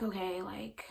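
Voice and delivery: hushed, whispered acceptance; resigned whisper. Retold or imitated. Student told the iPod can't be found, whispering in resignation. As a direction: let it drop to a defeated whisper. Retold resignation at bad news.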